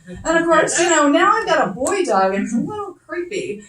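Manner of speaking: ordinary delivery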